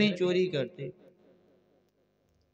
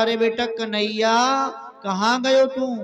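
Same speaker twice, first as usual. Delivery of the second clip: mother calling her son from afar, higher and louder; 4 semitones higher, 4 dB louder than usual. Acted. Mother calls out to find Kanhaiya, loudly. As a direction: call out for the hiding child. मैया ने फिर पुकारा.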